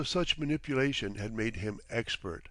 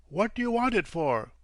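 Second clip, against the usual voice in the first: higher, wary demand; suspicious question; 8 semitones higher, 4 dB louder than usual. A narrator's reading. Captain suspicious of his guest's question. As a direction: probe him with suspicion. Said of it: "eyed him suspiciously".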